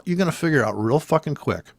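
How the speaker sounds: usual speaking voice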